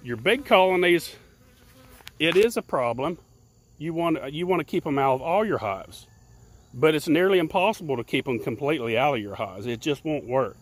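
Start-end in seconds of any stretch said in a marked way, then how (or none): none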